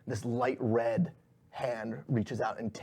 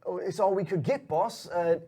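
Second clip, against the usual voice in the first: higher-pitched, faltering lackey voice; apologetic underling, voice raised in pitch; nervous henchman; 6 semitones higher than usual. Acted. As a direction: stammer excuses to the boss, pitched up. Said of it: "and, uh, he goes".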